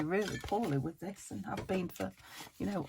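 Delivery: ordinary talk, nothing marked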